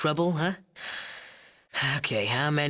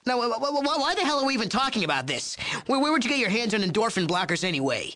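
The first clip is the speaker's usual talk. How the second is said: high, fast, stammering exasperation; rattled and talking fast; flustered; 9 semitones higher, faster than usual. Acted. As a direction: sputter it out, caught off guard. Flustered outburst at player.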